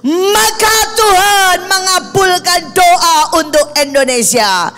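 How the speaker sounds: high, loud, sustained proclamation; full-force preaching. Real, not acted. Preacher calling believers to rise for Indonesia, impassioned shouted proclamation. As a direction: cry it out over the congregation like a rallying call.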